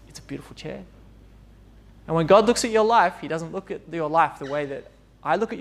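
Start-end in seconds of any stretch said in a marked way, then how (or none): 0.1-0.8 much softer, hushed admiration; soft admiring. Retold or imitated. Restorer admiring a broken chair, hushed and soft. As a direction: breathe it out in quiet wonder.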